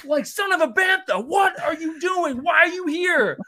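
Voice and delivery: high, loud exasperated yelling; losing it at someone reckless; flipping out. Retold or imitated. Ezra yelling at Sabine for risking everything, high and loud. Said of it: "Ezra, like, flipping out".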